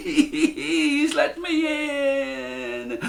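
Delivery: very high, slow, tearful begging; gasping plea. A narrator's reading. Jack begging the giant's wife tearfully. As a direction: beg breathlessly to be let in. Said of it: "Jack took a huge breath".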